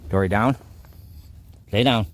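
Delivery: firm command. Real, not acted. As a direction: tell the dog to lie down.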